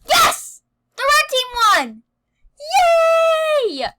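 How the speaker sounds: shrieking with joy, very high; excited shout. Real, not acted. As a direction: scream it out in victory. Team just won minigame.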